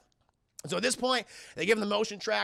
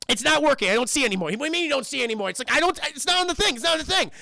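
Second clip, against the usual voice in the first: high, loud frantic back-and-forth; flustered panic; 7 semitones higher, 5 dB louder, faster than usual. Retold or imitated. Crewmates arguing over a dead motion tracker, frantic. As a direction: voice climbing as the panic builds.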